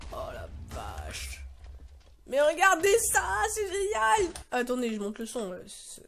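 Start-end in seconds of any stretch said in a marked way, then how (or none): 0.1-1.4 very low, hushed and slow; soft, drawn-out low murmur of amazement; stunned awe. Real, not acted. Stunned watching the explosions. 2.3-4.3 high, excited exclamation; pitched-up burst of enthusiasm; thrilled. Real, not acted. Thrilled by the explosions.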